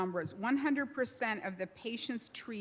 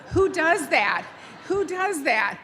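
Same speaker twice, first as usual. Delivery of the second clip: higher and louder, incredulous double take; amazed disbelief; 8 semitones higher, 9 dB louder, slower than usual. Retold or imitated. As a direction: retell it like you still can't believe it. Retold amazement at student builder.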